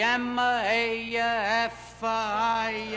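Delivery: each letter of M A F I A spelled out slowly and deliberately. Acted. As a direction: drag out the letters for the punchline.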